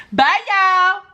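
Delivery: high, loud sing-song farewell; cheery goodbye. Real, not acted. To viewers before the camera cuts, excited. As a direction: wave them off brightly.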